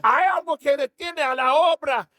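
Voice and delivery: rising sermon fervor; fervent preaching. Acted. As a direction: preach it hard to the congregation. Preaching builds, pitch up.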